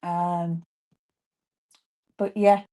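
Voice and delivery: ordinary delivery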